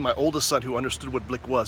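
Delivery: normal talking voice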